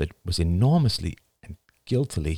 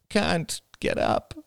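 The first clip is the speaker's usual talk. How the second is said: put-on slow, small sulky kid voice; whiny child; 11 semitones higher, slower than usual. A narrator's reading. Son refusing his mother, slow pout. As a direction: drag it out, pouting.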